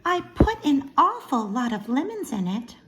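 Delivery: mild Mrs Watson voice. Acted. Mrs Watson voiced in read-aloud.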